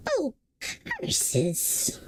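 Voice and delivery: high, soft fire-demon spit of a curse; spat curse. A narrator's reading. Fire demon Calcifer loses to Sophie, spitting a soft curse. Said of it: "Calcifer spat".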